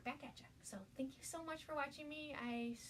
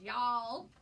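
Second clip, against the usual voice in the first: Southern y'all; 9 dB louder than usual. Pretend. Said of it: "Funny Southern expressions".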